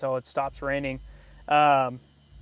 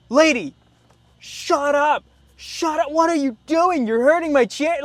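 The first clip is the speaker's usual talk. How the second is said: higher, louder mock outburst at the lady; retold yell; 12 semitones higher, 7 dB louder than usual. Buyer snapping at the teasing loan officer, loud high mock yell. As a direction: yell at her half-laughing to stop. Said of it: "And I was like".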